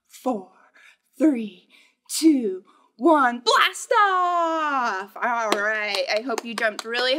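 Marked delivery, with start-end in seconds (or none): 0.1-3.4 building countdown. Acted. Countdown to blast off. 3.4-5.2 louder, higher blast-off cheer; big playful liftoff call; excited shout. Acted. Rocket launch game.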